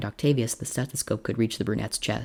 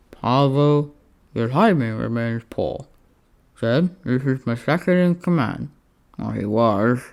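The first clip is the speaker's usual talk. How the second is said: dry Roman voice; 5 dB louder, slower than usual. A narrator's reading. Octavius dry reproach.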